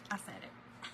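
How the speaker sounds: breathy whisper, no voice. Real, not acted. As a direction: whisper it under your breath. Repeats line, then whispers it.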